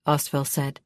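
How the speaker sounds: ordinary delivery, nothing marked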